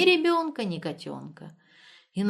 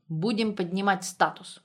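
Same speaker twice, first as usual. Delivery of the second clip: plain reading voice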